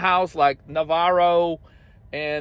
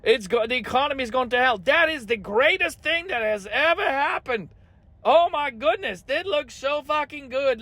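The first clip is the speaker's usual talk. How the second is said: higher, gushing mock delight; mocking Navarro; 5 semitones higher than usual. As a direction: ham up a cheerful spin doctor. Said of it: "he's like".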